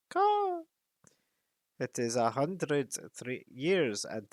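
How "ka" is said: high sharp caw; a bird's croaking call; raven caw. Acted. Raven caws between sentences, high sharp cry.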